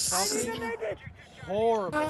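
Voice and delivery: loud exasperated rant; frustrated yell. Real, not acted. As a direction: vent at the game, loud. Gamer rages at game.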